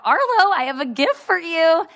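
sing-song aunt voice. Retold or imitated. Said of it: "And I was like".

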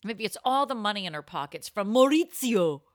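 normal talking voice

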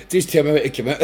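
laughing while speaking. Retold or imitated. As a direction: chuckle through the line. Retold reply while laughing.

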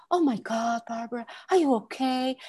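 a hint of a gushing, overdone sympathizer; half-hearted impression of a fussing visitor; mock dramatic visitor. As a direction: lightly send up the pitying friend. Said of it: "you see how I'm going?".

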